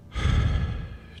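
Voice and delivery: long, voiceless exhaled sigh; relaxing sigh. Shown as a demonstration. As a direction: breathe out a sigh to let go. Models letting go in meditation.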